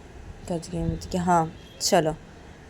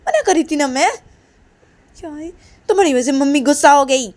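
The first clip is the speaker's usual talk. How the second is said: much higher and louder, shouting in a tantrum; angry child; 8 semitones higher, 7 dB louder than usual. Acted. Kajal scolds Reshma for telling her mother, shouting tantrum. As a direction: yell at your friend, furious and blaming.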